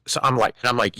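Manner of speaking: usual speaking voice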